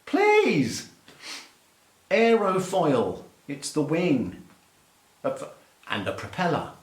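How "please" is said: very high-pitched, pleading protest; hammed-up exasperated plea; mock exasperation. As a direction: squeal it in disbelief. Mock rant at American pronunciation.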